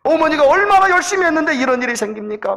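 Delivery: put-on children's protest, rising in pitch and force; mildly acted indignant complaint; complaining. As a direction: build a retold grievance toward the last question. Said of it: "불평하기 시작하는 거예요".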